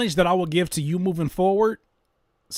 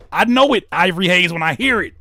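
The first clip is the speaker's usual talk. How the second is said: emphatic callout; 4 semitones higher, 8 dB louder than usual. Real, not acted. Calling out the beatmaker.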